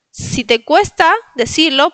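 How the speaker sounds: ordinary talk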